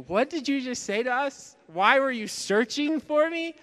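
a mother who can't believe what her son just said; Mary's stunned disbelief. Pretend. Mary's imagined reply to Jesus.

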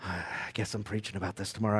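low, soft, rueful sigh of a line; a quiet groan of realization; resigned realization. Retold or imitated. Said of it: "I'm like".

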